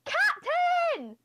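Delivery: very high, loud pixie scream; Bingo. Acted. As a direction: scream it like a tiny fairy. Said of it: "imitating Bingo, uh, [name] just shouts, or more like screams".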